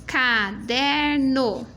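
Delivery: caderno drawn out very slowly; slow syllable reading. Shown as a demonstration. Teacher modelling a slow childlike reading. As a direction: read caderno like a child sounding it. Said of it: "E lesse".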